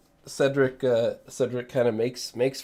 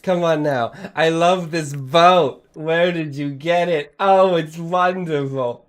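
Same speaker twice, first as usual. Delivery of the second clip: gushing friar; 6 semitones higher, 9 dB louder than usual. Acted. Friar admires the party's boat, gushing and loud. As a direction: coo over the boat like a smitten fan.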